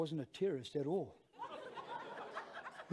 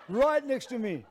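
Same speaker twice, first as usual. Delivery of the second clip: "Right next to me" loud and raised in pitch; incredulous, punched-up "Right next to me"; emphatic repeat; 6 semitones higher, 7 dB louder than usual. Real, not acted. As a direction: drive home "Right next to me" with force.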